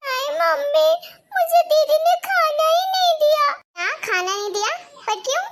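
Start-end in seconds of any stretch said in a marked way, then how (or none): 0.1-3.5 high, loud whining sob; tearful complaint. Acted. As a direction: whine it like a wronged little girl. Child complains, crying. (रोती हुई शिकायत)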